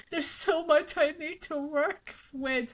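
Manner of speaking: a hint of a mopey friend; glum friend. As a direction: drag it out like a sulky patient. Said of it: "He's like".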